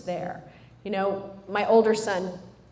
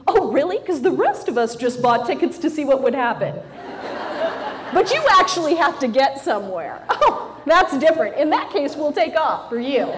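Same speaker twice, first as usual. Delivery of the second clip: sarcastic gate agent; 6 semitones higher, 7 dB louder than usual. Retold or imitated.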